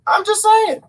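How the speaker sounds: high squeaky just saying; high sassy aside; high mock aside. Repeated, first high.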